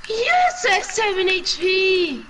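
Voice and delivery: high, loud victory yell; excited shout. Real, not acted. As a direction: scream it out in triumph. Clutch win in game.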